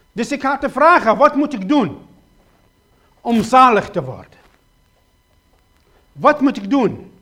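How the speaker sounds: loud, urgent pleading sermon voice; preacher crying out his questions to the congregation, loud and pleading; full-force preaching. Acted. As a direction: hammer each question home from the pulpit.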